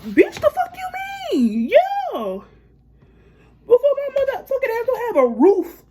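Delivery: very high-pitched, incredulous retort; indignant outburst. Retold or imitated. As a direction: shoot way up high in outraged disbelief. Indignant retort to boyfriend's jab.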